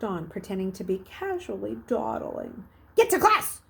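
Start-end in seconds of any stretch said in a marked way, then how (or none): 3.0-3.6 loud, high barked command; growled order. A narrator's reading. Hall monitor orders the dawdling boy, a loud angry bark. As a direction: snap it like an annoyed hall monitor. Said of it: "the monitor growled".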